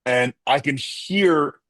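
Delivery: normal talking voice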